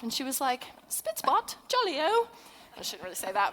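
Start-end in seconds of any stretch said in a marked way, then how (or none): 0.9-2.3 put-on high-pitched caricature of an English accent; mock English accent. Imitating an English friend's answer on the boat, mock English accent. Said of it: "that's how she sounds to me in her accent".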